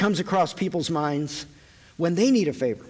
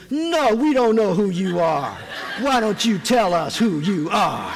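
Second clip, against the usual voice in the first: voice of the Holy Spirit; 3 dB louder, slower than usual. Pretend. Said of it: "the Holy Spirit says".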